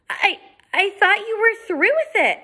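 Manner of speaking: put-on high, timid stammering girl; girl stammers to the stranger, high and scared. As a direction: squeak it out, terrified.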